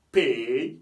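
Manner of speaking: "Pay" loud, with a drawn-out "ey"; English AY sound. Shown as a demonstration. Teacher reading the next example, drawn-out AY. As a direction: call out "Pay" with a long ending. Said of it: "ça donne le son A".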